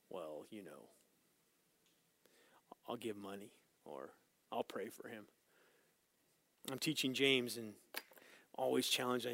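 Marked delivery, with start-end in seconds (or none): 0.1-5.3 very low, soft, halting reply; reluctant excuse. Acted. Believer asked to help a hurting brother, hedging quietly. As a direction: trail through it quietly, with hesitant gaps.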